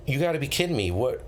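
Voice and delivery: normal talking voice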